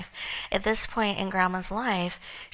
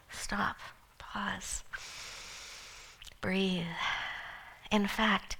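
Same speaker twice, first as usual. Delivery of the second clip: tender comfort; 6 dB quieter than usual. Retold or imitated. Grandma comforting heartbroken granddaughter.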